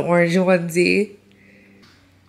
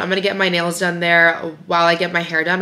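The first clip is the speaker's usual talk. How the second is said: ordinary talk, nothing marked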